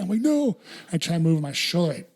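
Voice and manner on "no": high alarmed no. Retold or imitated. Dad crying out as he crashes into daughter, high alarm. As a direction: jump way up on the no, alarmed. Dad's reaction to crushing daughter.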